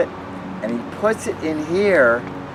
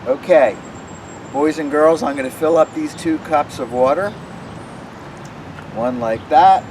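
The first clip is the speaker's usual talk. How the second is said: put-on children's magic show patter; 3 semitones higher than usual. Performing trick for children.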